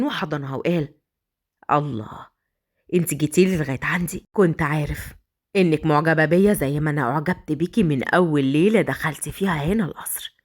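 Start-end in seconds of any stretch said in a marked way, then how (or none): 1.7-10.4 low, oily flirting; lower, pleased with himself; smug seducer. Acted. Yassin flirts, embraces her. (صوت رجل متغزل بنبرة منخفضة)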